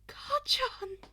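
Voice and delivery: high startled call; shocked cry. Acted. Izuku calling out to Kacchan in alarm.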